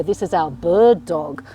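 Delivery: ordinary talk, nothing marked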